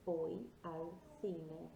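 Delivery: very slow, careful Spanish; model pronunciation. Shown as a demonstration. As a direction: stretch it out slowly for learners to repeat. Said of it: "Repeat after me".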